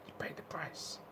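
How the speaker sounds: whispered you pay the price; breathy hushed repetition of "You pay the price". Real, not acted. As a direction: whisper it as an aside. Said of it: "repeating the point".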